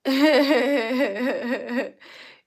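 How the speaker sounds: big, high burst of laughter; loud laughter. Real, not acted. Loud burst of laughter mid-stream.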